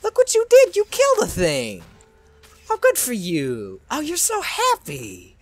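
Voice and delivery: high cooing, like to a pet; mock cooing praise. Cooing at characters who killed the thing, mock praise. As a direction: gush in a high-pitched, babying voice.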